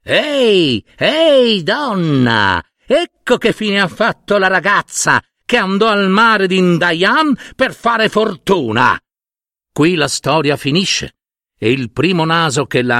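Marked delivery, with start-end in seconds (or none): 0.0-9.0 birds crying out. Acted.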